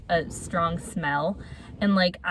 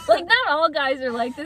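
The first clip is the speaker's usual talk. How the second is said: laughing high and bright; 7 semitones higher than usual. Real, not acted. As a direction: laugh through the words. Laughing while backing off claim.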